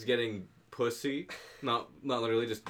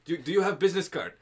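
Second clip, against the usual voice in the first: mock Russian accent; 6 semitones higher than usual. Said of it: "No, he was not Russian".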